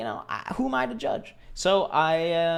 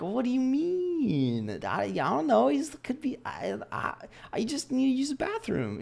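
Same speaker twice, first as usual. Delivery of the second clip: high, small, innocent half-whisper; playing dumb; 11 semitones higher than usual. Telling the roommate he just needs the bathroom, high and innocent. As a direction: feign innocence in a tiny voice.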